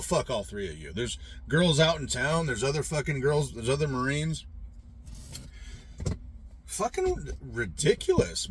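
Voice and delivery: usual speaking voice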